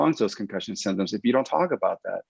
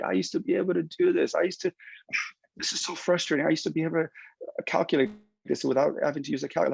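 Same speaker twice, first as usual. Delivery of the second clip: higher, exasperated whining voice; frustrated patient; 8 semitones higher than usual. Retold or imitated. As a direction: whine it like a fed-up patient. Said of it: "all you do is like".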